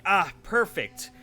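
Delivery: pleased with self. Retold or imitated. Retelling typing a word out, mock-pleased. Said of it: "and I'm like".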